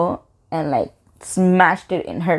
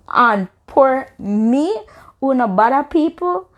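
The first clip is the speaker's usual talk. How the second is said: slow, higher-pitched, drawn-out indignation; disbelief; 6 semitones higher, slower than usual. Retold or imitated. Girl protesting a man laid hands on her, slow incredulous lament. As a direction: stretch out each phrase in mock-wounded disbelief. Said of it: "I'm in disbelief".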